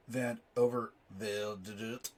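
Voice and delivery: Overvelde sounded out slowly and loudly, unsure of it; loud, halting attempt at the name Overvelde; fumbled pronunciation. Real, not acted. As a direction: stumble through Overvelde at a drawn-out pace. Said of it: "I can't pronounce crap for anything".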